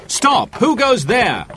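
high, loud challenge; challenging cry. A narrator's reading. Robin challenges an approaching cart, loud cry. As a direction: halt the stranger on the path. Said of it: "he cried".

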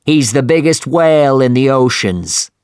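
rally the crew like a grim captain; commanding captain. A narrator's reading. Ahab speaks to crew.

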